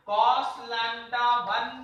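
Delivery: very slow, each word stretched; slow motion. Shown as a demonstration. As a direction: drag every syllable out deliberately. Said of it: "देखो slow motion में बोलेंगे".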